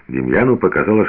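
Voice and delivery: ordinary delivery, nothing marked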